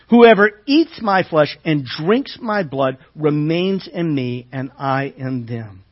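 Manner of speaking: loud, measured preaching read; scripture reading. Acted. Preacher reading Jesus' words to the congregation, proclaiming. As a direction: proclaim each line slowly to the room.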